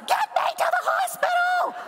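high, shrieking mock shout; frantic demand. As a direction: yell at the taxi driver, hammed up. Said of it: "I was like".

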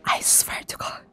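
soft, voiceless whisper; spooked whisper. Real, not acted. Player alone in the dark forest, spooked whisper. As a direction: whisper it under the breath.

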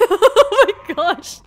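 high, drawn-out laughing outburst. Real, not acted. Player told to kill infected cops, laughing. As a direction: crack up through the words.

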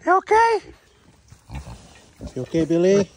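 high, bright call to the animals; pet talk. Real, not acted. Greeting Maggie the barn cat and Billy, high pet talk.

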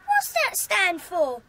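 ordinary talk

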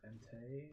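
"Entei" said as en-TAY; accented final E. Shown as a demonstration. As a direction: end "Entei" on an ay sound, as if the E had an accent. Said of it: "there were an accent on it".

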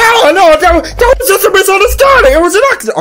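high, frantic excuse; hammed-up monster plea; pleading monster. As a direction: protest innocence in a silly high voice.

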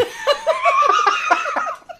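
high, loud burst of laughter; loud laughing fit. Real, not acted. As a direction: double over laughing. Searing pain line amuses her.

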